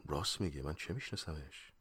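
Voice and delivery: low, hushed inner thought; said to oneself. Acted. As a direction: murmur it privately, dropping the voice. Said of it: "پیش خودم گفتم". (آهسته، پیش خود)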